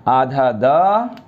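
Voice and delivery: slowly stretched half consonant; teacher dictating slowly; slow spelling out. Shown as a demonstration. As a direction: draw out each sound for the class. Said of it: "यहाँ पे भी आप ध्यान रखेंगे".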